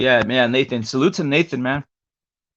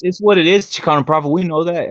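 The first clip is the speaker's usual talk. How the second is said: normal talking voice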